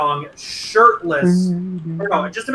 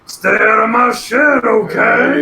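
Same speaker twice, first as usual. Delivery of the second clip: shouting; 4 semitones higher, 4 dB louder, slower than usual. Acted. Voicing her warning about shed.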